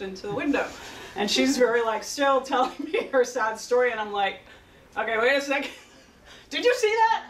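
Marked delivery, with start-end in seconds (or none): 5.0-7.2 high-pitched retold astonishment; startled outburst. Therapist interrupting a client's sad story over the bird, startled. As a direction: break in, pitch leaping up in disbelief.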